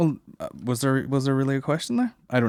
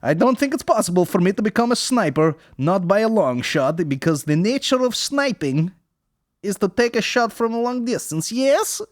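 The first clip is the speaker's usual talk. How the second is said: high, loud mock Russian accent; 11 semitones higher, 4 dB louder than usual. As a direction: play a Russian joke teller. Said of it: "my new character, the Russian guy".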